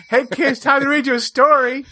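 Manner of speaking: high, sing-song bedtime voice; cheerful parent calling the kids; mock chirpy parent gathering kids for a story; storytime parent. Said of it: "you can imagine, like".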